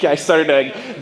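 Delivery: normal talking voice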